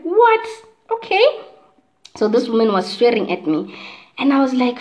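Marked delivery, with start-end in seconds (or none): 0.0-1.2 high startled what; a disbelieving what, pitched way up; shocked what. Retold or imitated. Reading the insult and blurting what in shock. Shocked reply to being sworn at.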